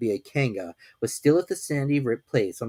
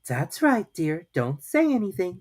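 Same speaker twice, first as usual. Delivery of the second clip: calm motherly Kanga; 7 semitones higher than usual. A narrator's reading. Kanga soothing.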